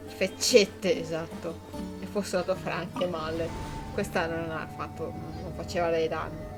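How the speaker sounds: ordinary delivery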